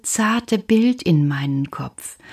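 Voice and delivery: plain reading voice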